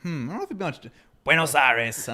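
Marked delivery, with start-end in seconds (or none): 1.2-2.1 put-on Boston accent. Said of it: "Buenos Aires in a Boston accent".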